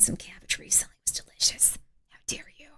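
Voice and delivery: hushed whisper so the sleepers stay asleep. Real, not acted. Whispering to sleeping chat, hushed. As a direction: breathe the words out unvoiced.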